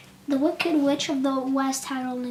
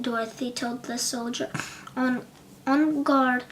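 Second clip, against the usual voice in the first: ordinary delivery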